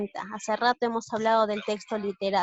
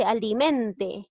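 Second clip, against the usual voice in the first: slow "alimente", leaning on MEN; teacher's deliberate ali-MEN-te; stress on penultimate syllable; much slower than usual. Shown as a demonstration. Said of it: "¿dónde lleva la mayor fuerza de voz?".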